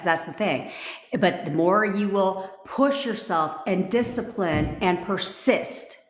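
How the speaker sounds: ordinary talk, nothing marked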